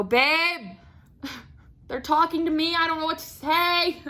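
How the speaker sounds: high, hammed-up plea to a partner; panicked call for help. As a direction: call over in mock helplessness. Acts out calling husband for help.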